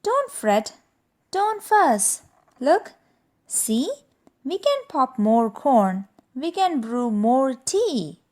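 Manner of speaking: high squeaky mouse voice; soothing little squeaks. Acted. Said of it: "Mouse squeaks".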